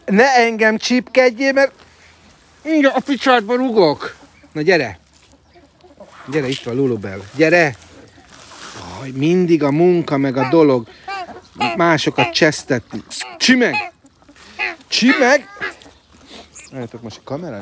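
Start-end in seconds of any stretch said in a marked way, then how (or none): 0.1-4.2 high, exasperated telling-off; mock scolding. Real, not acted. Keeper scolds a pecking duck in a high exasperated voice. 13.4-15.5 calling out. Real, not acted. Calling a bird by name.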